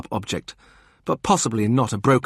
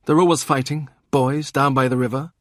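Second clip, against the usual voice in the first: higher dismissive sister; Kat voiced; 6 semitones higher, 4 dB louder than usual. Kat explains boys fight by the river, higher voice. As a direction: wave it off as nothing unusual.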